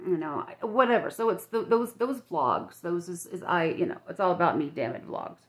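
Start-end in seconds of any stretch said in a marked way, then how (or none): none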